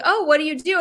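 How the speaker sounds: lightly mocked friendly curiosity; chirpy small talk. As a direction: imitate a breezy social opener. Acting out small talk when out.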